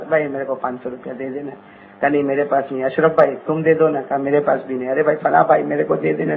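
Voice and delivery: very low, soft acted request; muted begging. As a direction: play a subdued exchange of asking and refusing. Acts out begging neighbours for money. (دبی آواز میں مانگنے کی نقل)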